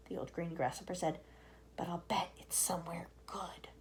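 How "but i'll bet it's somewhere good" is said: soft hopeful whisper. Acted. Old grasshopper whispering hopeful guess. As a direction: confide it hopefully.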